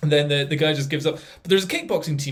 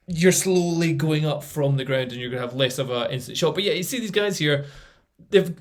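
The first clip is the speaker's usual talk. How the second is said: ordinary talk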